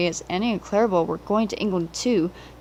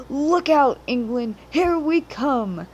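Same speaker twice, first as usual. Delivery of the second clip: excited call; 5 semitones higher, slower than usual. A narrator's reading. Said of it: "The engines whistled".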